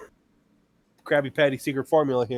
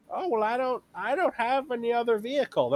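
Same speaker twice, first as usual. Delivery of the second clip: mock customer voice; 11 semitones higher than usual. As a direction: play the puzzled customer, pitched way up. Customer quoted in retail story.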